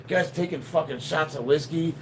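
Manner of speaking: normal talking voice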